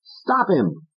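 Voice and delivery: high insistent order; Fats. Acted. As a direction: push him.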